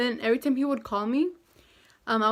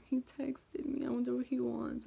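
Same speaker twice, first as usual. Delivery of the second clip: hushed happy thought; 2 semitones higher, 8 dB quieter than usual. Retold or imitated. Girl rereads his text, quietly hopeful. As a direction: murmur it hopefully, under the breath. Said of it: "I was happy".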